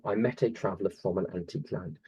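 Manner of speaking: "I met a traveller from an antique land" on the de-dum beat; iambic rhythm. Shown as a demonstration. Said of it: "it's this meter, this rhythm".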